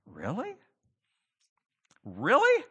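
second Really rising high in wonder; disbelieving delight, climbing up; mock amazed. Believers shocked by results in heaven, mock amazed rising. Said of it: "the opportunity to say".

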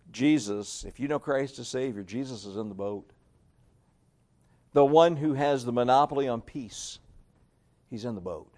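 ordinary talk, nothing marked

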